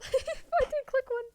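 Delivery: high squealing laugh through words. Real, not acted. Laughing at missed choice.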